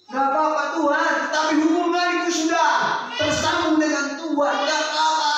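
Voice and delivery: high, loud fervent reply; impassioned acceptance. Acted. David answering God about his sin, loud and high. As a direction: declare it with full fervor.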